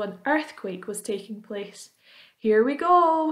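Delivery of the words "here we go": high, loud, excited call; excited shout. A narrator's reading. Grasshopper hopping up and down with excitement, excited shout. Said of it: "shouted the old green grasshopper".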